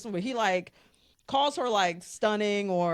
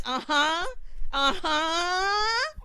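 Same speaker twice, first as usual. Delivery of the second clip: high, rising uh-huh, second one drawn up higher; knowing uh-huh; 10 semitones higher, much slower than usual. Retold or imitated. Podcaster retelling her reaction to the show, high knowing uh-huh. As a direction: react like you caught them out.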